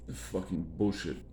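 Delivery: normal talking voice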